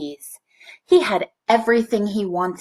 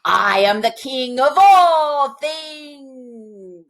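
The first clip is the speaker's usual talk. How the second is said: higher, louder, slow royal boast; grand proclamation; 5 semitones higher, 4 dB louder, much slower than usual. A narrator's reading. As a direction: declare it like a greedy king crowned at last. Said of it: "proclaimed King Normus".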